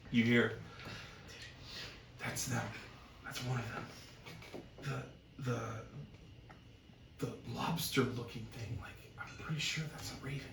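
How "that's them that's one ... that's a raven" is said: hushed villager. Acted. Villager confiding at a nearby table, hushed. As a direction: keep it under your breath.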